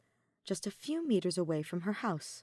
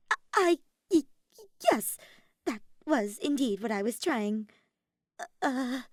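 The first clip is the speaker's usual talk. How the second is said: high, halting confession; embarrassed, stuttering admission; flustered stammer; 5 semitones higher, 5 dB louder than usual. A narrator's reading. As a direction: stumble nervously from squeak toward composure. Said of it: "red-faced and unable to believe".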